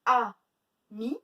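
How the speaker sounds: AMI as loud A, long pause, mi; split into syllables. Shown as a demonstration. Teacher counting syllables for pupils, syllable by syllable. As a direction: break Ami into two well-separated beats. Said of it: "deux syllabes".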